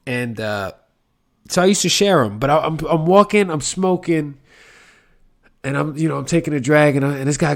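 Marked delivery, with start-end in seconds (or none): none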